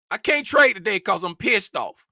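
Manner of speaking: usual speaking voice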